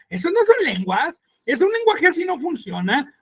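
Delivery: lightly voiced critic dismissing tongues; mocking critic. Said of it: "y se burla".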